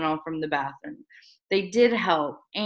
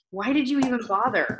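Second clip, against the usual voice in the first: higher, icy question; frigid; 5 semitones higher than usual. Acted. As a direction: ask it coldly, pitched up. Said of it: "I asked frigidly".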